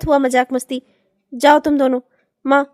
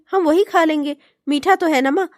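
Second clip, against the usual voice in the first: usual speaking voice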